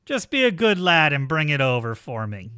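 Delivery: loud, condescending villain; loud villain's order across a distance; calling back. Acted. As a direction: project it firmly up to them.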